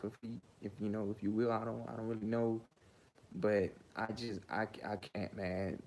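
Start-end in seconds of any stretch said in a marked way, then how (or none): none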